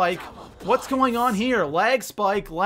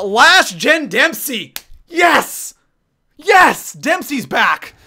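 loud, high bursts of real excitement; hyped; 4 semitones higher, 7 dB louder, much slower than usual. Real, not acted. Streamer spots a last gen player in chat, hyped. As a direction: cheer a favourite's return, punching each yes. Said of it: "I get so hyped".

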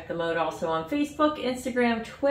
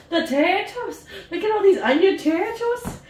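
high, excited imitation of the potato sellers; excited potato quote; 11 semitones higher, 6 dB louder than usual. Vlogger imitating the Irish museum potato sellers, high excited squeal. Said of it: "'cause I was like".

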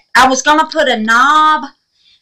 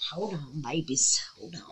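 whisper; 7 semitones lower, 16 dB quieter than usual. Real, not acted. Whispering to viewers during delicate work.

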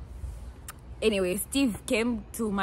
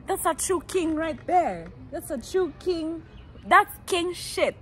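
higher, slow, showy praise; hyped praise; 8 semitones higher, slower than usual. Pretend. Praising the boy who sent airtime, high and slow. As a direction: hype him up slowly.

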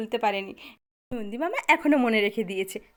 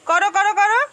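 loud coaxing; 8 semitones higher, 11 dB louder than usual. Real, not acted. Coaxing son to wave hi.

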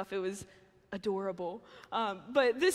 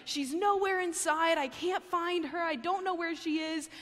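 a touch higher, anxious and searching; higher-pitched, anxious retelling of a worried call; worried husband; 6 semitones higher than usual. Retold or imitated. Husband searching the house for the puppy, mildly anxious. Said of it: "he's like".

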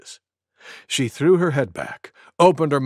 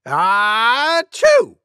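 big exaggerated sneeze; loud sneeze; 8 semitones higher, 5 dB louder than usual. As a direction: sneeze hard, high and loud. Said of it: "Maybe as loud as this".

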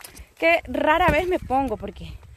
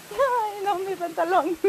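dismayed; 7 semitones higher, slower than usual. Real, not acted. Realizing she tore her pants.

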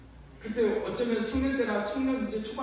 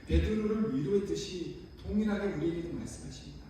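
ordinary talk

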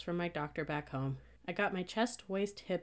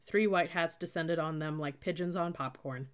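usual speaking voice

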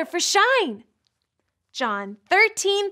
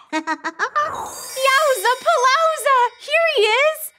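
excited; 8 semitones higher, slower than usual. Acted. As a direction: announce him like a big surprise. Host greets puppet excitedly.

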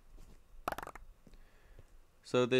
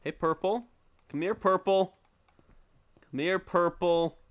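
calling out; 6 semitones higher, 4 dB louder than usual. Real, not acted. Calling another player over.

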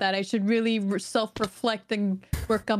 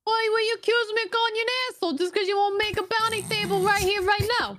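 very high, indignant New Yorker bit; mock New York accent; 12 semitones higher than usual. Friend denying an insult in play, mock New York accent. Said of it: "as a New Yorker, I feel offended".

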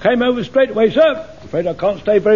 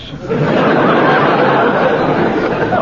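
joyful laugh. Acted. Jones overjoyed at promotion.

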